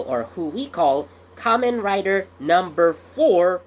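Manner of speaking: ordinary talk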